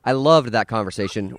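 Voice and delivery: ordinary talk, nothing marked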